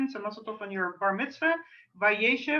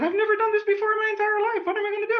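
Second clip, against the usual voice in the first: higher, louder, fast alarmed cry; mock panic; 11 semitones higher, 8 dB louder, faster than usual. Retelling her first time leading prayers, mock panicked. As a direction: act out the old panic, half-joking. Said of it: "And I said".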